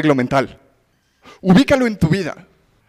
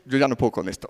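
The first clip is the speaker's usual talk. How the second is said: defeated sigh; 7 semitones lower, 7 dB quieter, faster than usual. Retold or imitated. Despairing inner voice quoted.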